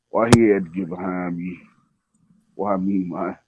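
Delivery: quiet, uneasy muttering; nervous mutter. Retold or imitated. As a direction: mutter it nervously under your breath. Nervous thought, dealer behind.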